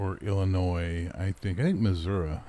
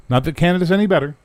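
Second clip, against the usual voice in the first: quick loud aside; 10 semitones higher, 10 dB louder, faster than usual. Real, not acted.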